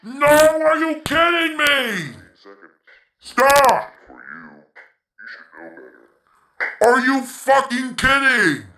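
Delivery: high, slow outraged yell; furious yelling. Real, not acted. Gamer screaming in outrage at the game. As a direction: rage at the screen.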